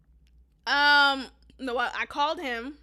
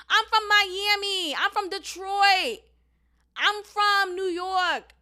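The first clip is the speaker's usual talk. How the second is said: slow, drawn-out mocking hometown boast, higher pitched; mocking imitation; 5 semitones higher, 3 dB louder, slower than usual. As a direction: parody a drawn-out "I'm from..." brag. Mocking people who cite their hometown.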